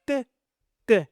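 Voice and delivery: the sound "T" as a short crisp tap; letter sound demo. Shown as a demonstration. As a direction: model the sound "T" on its own. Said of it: "T fait le son".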